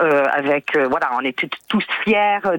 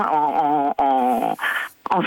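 slow, faltering words as tears rise, pitch dropping; the caller's throat tightens as she speaks of her faith; voice catching with emotion; 3 semitones lower, much slower than usual. Real, not acted. An American in France, moved by her country's 250th, voice catching. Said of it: "je suis très sensible".

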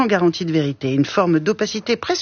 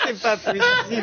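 laughing disbelief; 13 semitones higher than usual. Real, not acted. Host cracking up at the guest's joke, laughing in disbelief.

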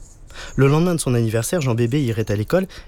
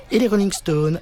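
high, insistent schoolgirl questioning; eager little girl; 8 semitones higher than usual. A narrator's reading. Classmate quizzes the supposed English boy, eager. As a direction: pipe up high and keen.

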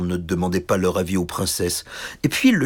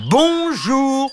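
tiny knight greeting; 5 dB louder than usual. Acted. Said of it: "refit le petit chevalier".

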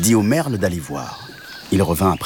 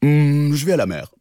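long mooing hum, then the words; cow character; 6 semitones higher than usual. Acted. Cow agrees to the frog's plan, humming cow voice. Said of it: "répondit la vache".

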